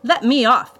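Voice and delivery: high, loud objection; protesting. A narrator's reading. Brother objects to flying with the villain, protesting. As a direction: protest loudly at being taken along. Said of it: "Gerhardt protested".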